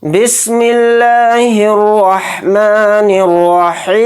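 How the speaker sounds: slow, drawn-out chanted tilawat; Quranic recitation. Acted. Teacher opening the lesson, slow chanted recitation. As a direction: intone each word slowly and reverently. Teacher opens with ta'awwuz and basmala.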